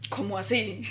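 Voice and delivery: surprised laugh, soft; amused astonished laugh; laughing surprise. Retold or imitated. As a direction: laugh in disbelief at the news.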